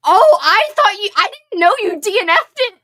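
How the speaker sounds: high, loud, startled protest; shocked disbelief. Real, not acted. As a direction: react as if betrayed by a friend. Shocked friend didn't finish book.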